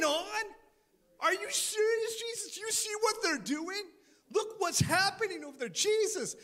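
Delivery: high, loud desperate pleading; crying out. Acted. Frightened people crying out to Jesus, high desperate plea. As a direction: cry out to Jesus in panic. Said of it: "they're crying out".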